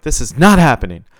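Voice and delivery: shouted. A narrator's reading. Promoter forbids fighting with a woman, shouting urgently. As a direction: lay down the law at a shout. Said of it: "the promoter shouted".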